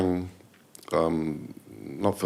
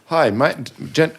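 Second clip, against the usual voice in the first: flubbed announcer read; 8 semitones higher, 6 dB louder than usual. Retold or imitated. Jermaine fluffing his PSA read.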